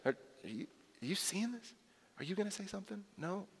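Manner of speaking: hushed, urgent whispering aside; hushed disciple. Pretend. Disciples muttering to each other about Jesus washing feet, hushed. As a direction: whisper it so Jesus won't hear. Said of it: "I'm sure they're shooting looks at each other".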